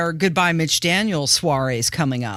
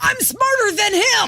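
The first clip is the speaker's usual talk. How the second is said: mocking smug voice. Mocking what critics think.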